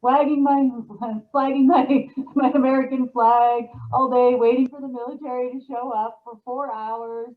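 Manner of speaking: high, loud mock sulk about the no-show; mocking her own sad porch vigil, pitched high and loud; mock whiny complaint. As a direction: whine it like a let-down child waiting on the porch. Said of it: "I called Tom and I was like".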